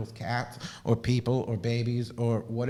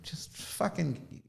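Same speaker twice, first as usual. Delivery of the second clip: whispered swear; 5 semitones higher, 8 dB quieter, much slower than usual. Real, not acted. Cursing under her breath to viewers, whispered. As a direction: drop to a whisper for the curse.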